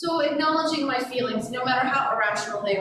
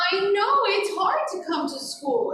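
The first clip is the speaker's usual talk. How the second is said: put-on high, gentle comfort for a crying toddler; soothing; 9 semitones higher than usual. Teacher comforting crying toddler, high gentle reassurance. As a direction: soothe a child at drop-off. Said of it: "two-year-olds coming in for separation".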